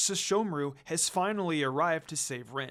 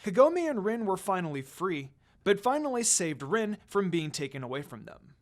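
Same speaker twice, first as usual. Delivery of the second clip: plain reading voice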